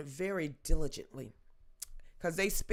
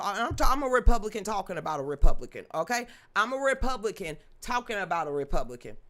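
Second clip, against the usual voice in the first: louder, fast, pressing the point; loud insistence; 6 dB louder, faster than usual. Real, not acted. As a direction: drive it home hard. Insisting loudly on her point.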